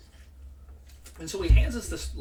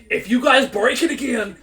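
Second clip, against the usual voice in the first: louder, higher mock coach rant; imitating a furious coach; 5 semitones higher, 6 dB louder than usual. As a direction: play the fed-up gym coach laying down the law. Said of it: "he flips out and he goes".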